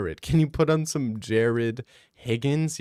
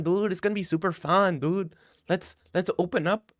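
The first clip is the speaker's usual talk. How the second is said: higher, excited buddy voice throughout; a higher, hammed-up Mexican dude impression; mock Mexican bro; 5 semitones higher than usual. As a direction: hype a friend up to go get tacos. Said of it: "You're hearing them talk like".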